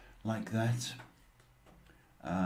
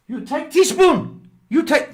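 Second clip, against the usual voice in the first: high, loud, broken-English orders; mock Chinese accent; 14 dB louder than usual. Landlady laying down rules to lodger, high loud mock accent. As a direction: bark out kitchen rules in a put-on accent, much higher. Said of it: "that's how she talked".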